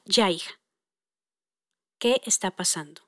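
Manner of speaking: low, soft, tender questioning. Retold or imitated. Mom hugs daughter and asks, hushed and tender. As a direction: drop the voice to comfort, leaving pauses. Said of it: "mi tono de voz también va bajando".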